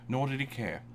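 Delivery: ordinary talk, nothing marked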